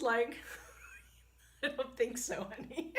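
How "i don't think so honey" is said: amused retort. Retold or imitated. Husband dismissing her, laughing.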